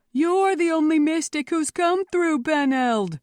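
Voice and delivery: high, loud accusation; cry from crowd. A narrator's reading. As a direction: shout from the back of a mob. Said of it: "cried a voice from the back of the mob".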